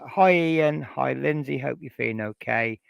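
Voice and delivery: ordinary delivery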